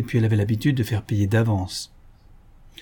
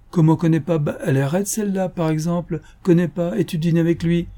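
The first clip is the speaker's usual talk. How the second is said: scandalised, raised-voice questioning; incredulous echo; 7 semitones higher than usual. A narrator's reading. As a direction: throw his words back at him, pitched up. She throws his words back, incredulous.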